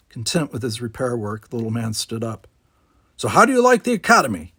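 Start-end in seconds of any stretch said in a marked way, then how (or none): 3.2-4.5 put-on grumpy old man.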